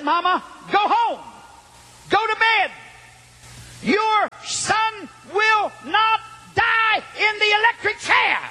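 high, slow preacher's shout; prophetic proclamation. Retold or imitated. Preacher promising a mother her son will live, shouted prophecy. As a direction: proclaim it word by word, pitched high.

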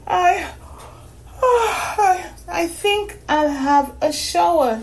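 put-on high, sleepy girl voice; a tired little girl asking sweetly; young girl voice. A narrator's reading.

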